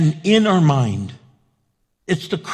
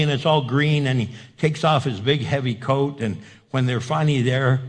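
ordinary talk, nothing marked

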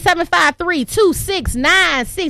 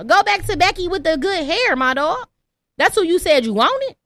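ordinary delivery

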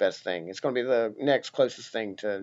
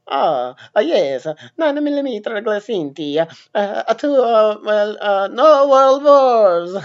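hammed-up Italian accent, higher and louder; a shoddy Italian accent with lots of hesitation; exaggerated Italian-accented boomer, rising higher and louder; Super Mario impression; 8 semitones higher, 10 dB louder than usual. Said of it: "my super shitty, like, Super Mario impression".

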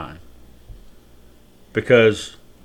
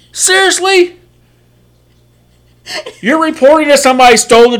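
mock incredulous cop; 14 semitones higher, 5 dB louder than usual. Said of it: "He's so mad".

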